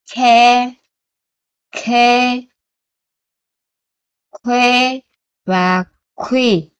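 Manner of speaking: each "kha", "kho", "khu" set apart with clear aspiration; syllable drill. Shown as a demonstration. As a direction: model each syllable for students.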